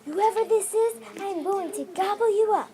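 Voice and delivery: high, loud menacing yell; a child playing a crocodile threatening to gobble someone up. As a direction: kid voicing a monster's threat, yelled up high. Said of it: "the crocodile yelled".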